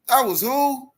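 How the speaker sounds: high, loud incredulous "who?"; outraged high-pitched who; shocked disbelief. Real, not acted. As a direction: squeal out the question in disbelief. Disbelief at rumor.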